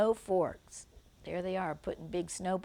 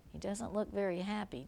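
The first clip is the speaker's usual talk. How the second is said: usual speaking voice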